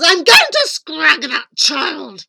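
enraged queen's yell, starting high and loud; raging storybook villain; wild yelling. A narrator's reading. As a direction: go absolutely wild with fury, then ease off. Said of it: "The queen went absolutely wild. She yelled".

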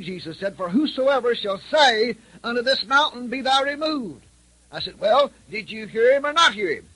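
high, loud preaching insistence; loud pressing. Retold or imitated. Preacher pressing the widow to hear Jesus' words, loud and high. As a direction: drive the verse home at full force.

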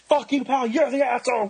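high-pitched retold yell; mock screaming back. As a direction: imitate a man yelling back in a row. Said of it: "started screaming at the back".

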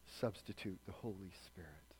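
hushed. Real, not acted. Preacher applies the story.

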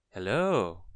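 a loud little hello from a small creature. A narrator's reading. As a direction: call out as a little voice. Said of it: "A little voice".

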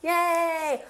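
high, loud "Yay"; playful celebratory whoop; mock cheer. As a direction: cheer as if treats arrived. Joke cheer at donuts arriving.

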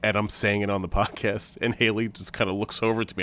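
ordinary delivery

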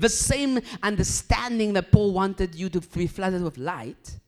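loud, high, slow proclamation; preaching. Acted. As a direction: proclaim the verse over the congregation. Preaching verse at full force.

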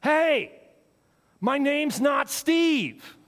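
loud, high, slow punchline; annoyed fan yelling back into the crowd; fed-up shouted reply. Acted. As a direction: hit each word of the comeback. Guy finally answers the caller.

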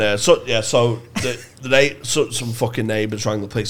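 ordinary delivery

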